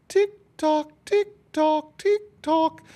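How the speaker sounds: high sing-song tick-tock; ticking clock. Acted. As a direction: mimic a clock while the cake bakes. Clock sound in story.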